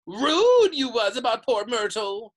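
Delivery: high, loud, drawn-out jeer; taunting poltergeist. A narrator's reading. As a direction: stretch out the accusation, loud and impish. Peeves goes on taunting.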